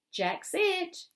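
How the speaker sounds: high, loud playground announcement; excited child's shout. A narrator's reading. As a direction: call it out to the whole game. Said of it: "she shouts".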